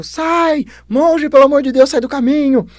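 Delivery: high, loud desperate yell; acted shout. Trainer begs the monk to clear the elephant's path, frantic shout. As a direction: shout a frantic warning at full pitch. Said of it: "o cara gritando".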